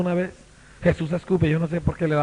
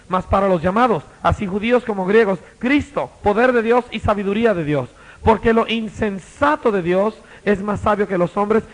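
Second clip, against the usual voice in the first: forceful scripture reading; 4 semitones higher, 4 dB louder than usual. Acted. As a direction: read the verses louder and louder, slowing at the end. Reads scripture with rising force. (lectura bíblica proclamada con fuerza)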